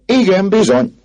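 high, loud confirmation; crowd answering. A narrator's reading. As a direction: shout back for the whole crowd. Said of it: "felelték a többiek".

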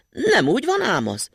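high, insistent little voice objecting; tiny boy protesting. A narrator's reading. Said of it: "tiltakozott Matyi".

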